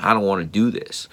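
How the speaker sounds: ordinary talk, nothing marked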